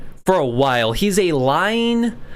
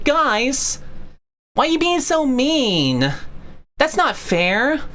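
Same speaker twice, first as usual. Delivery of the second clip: high, pleading mock voice, slow at the end; higher pleading imitation of a fanboy; whiny mock defender; 8 semitones higher, slower than usual. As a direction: mimic a hurt, whining defender. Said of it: "he has to step in and be like".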